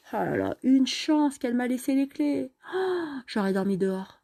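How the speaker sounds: a lightly acted relieved cry; relieved exclamation. Alicia relieved she has the keys, a mild exclamation. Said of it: "Alicia s'écrie".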